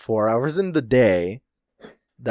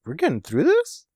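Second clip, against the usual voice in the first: high-pitched disbelief, rising at the end; imitating dawning amazement; mock surprised Killer; 5 semitones higher than usual. Killer realising they might survive, mock surprise. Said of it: "And now Killer's like".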